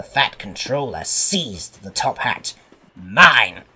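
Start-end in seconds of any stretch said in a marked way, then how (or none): 3.0-3.6 loud, low, indignant burst; cross. A narrator's reading. Fat controller seizing his top hat, cross. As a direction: claim it loudly and crossly. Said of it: "he said crossly".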